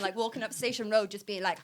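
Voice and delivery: ordinary delivery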